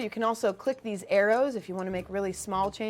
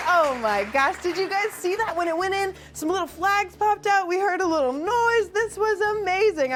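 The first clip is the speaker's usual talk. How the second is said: excited; 8 semitones higher than usual. Real, not acted. Celebrating hole in one.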